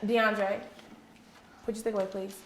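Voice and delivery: normal talking voice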